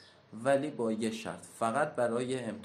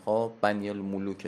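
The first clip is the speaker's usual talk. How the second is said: ordinary delivery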